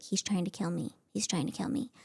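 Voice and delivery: scared murmur. Retold or imitated. Wife waking at night fearing her husband, frightened murmur. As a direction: murmur it in terror under the breath. Half-asleep paranoid inner thought.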